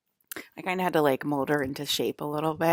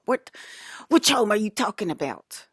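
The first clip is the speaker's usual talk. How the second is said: louder, higher exasperated challenge; annoyed demand; 5 semitones higher, 4 dB louder than usual. Retold or imitated. Scolding Brandon about which home, exasperated and loud. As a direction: push back hard, fed up. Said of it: "but not in that tone".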